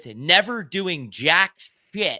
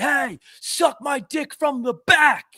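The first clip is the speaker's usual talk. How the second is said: high, drawn-out mock yell; yelling kid; 10 semitones higher, 3 dB louder, slower than usual. Imitating a Boston kid heckling another guy, yelling. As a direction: holler it slowly across a Boston street. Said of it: "some kid yelling at another guy".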